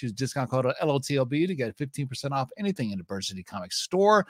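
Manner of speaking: ordinary talk, nothing marked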